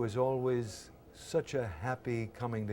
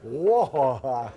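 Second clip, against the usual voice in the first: louder startled whoa through a laugh; startled mock whoa; 3 semitones higher, 9 dB louder than usual. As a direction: do the famous double take. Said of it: "he's like".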